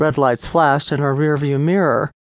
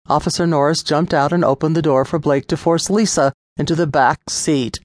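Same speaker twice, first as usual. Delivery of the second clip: plain reading voice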